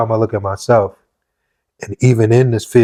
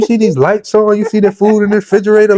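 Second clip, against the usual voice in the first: higher, louder mother, a bit fast; imitating his mother; 12 semitones higher, 5 dB louder than usual. Son imitating his mother proving her love, higher and louder. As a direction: ask like a mom pointing at the bills.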